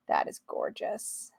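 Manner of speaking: slow, breath-only whisper of admiration; awed whisper. Real, not acted. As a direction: whisper it slowly, as if stunned by beauty. Awed first reaction to card.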